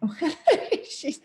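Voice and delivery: high burst of laughter on oh; a sudden real laugh at the cat. Real, not acted. Teacher laughs as her cat joins in, high laughing oh.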